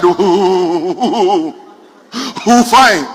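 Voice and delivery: mock stammering chorus reply; class mimicking stammerer. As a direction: echo a stammerer with a long hooted onset. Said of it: "The whole class shouted".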